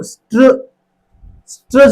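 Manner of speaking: स्ट्र alone, with only a short schwa after it; isolated consonant cluster. Shown as a demonstration. Teacher isolating opening consonants, स्ट्र sounded on its own. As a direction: cut स्ट्र off before the ee arrives. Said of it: "वो consonant है".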